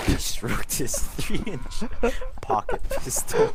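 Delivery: cracking up mid sentence; laughing through it. Real, not acted. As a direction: fight to read while giggling. Reader cracking up mid reading.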